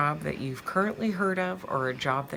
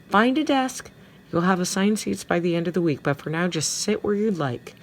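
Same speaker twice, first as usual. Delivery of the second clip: high, raised teacher's call; 5 semitones higher, 4 dB louder than usual. A narrator's reading. Homeroom teacher directing students to desks as the bell rings, calling out. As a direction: call it out across the classroom. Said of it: "and Missus Ambler calls".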